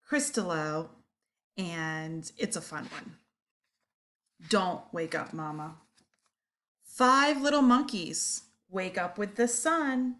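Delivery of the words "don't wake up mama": ordinary delivery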